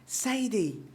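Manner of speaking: lightly voiced crackly old woman calling; a hint of a crackly old lady calling out; scratchy old voice. A narrator's reading. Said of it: "in her scratchy, crackly voice".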